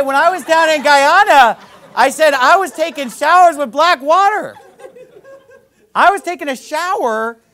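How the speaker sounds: high, loud, laughing story; loud amused exclamation; laughing disbelief. Retold or imitated. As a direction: crack up over the brown water. Telling about brown shower water.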